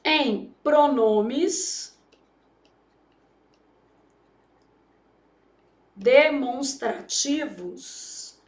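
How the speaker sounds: very slow, word by word with long pauses; slow dictation. Shown as a demonstration. Teacher dictating the crase rule slowly. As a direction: let students copy the rule down. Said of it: "acento grave só pode ser empregado".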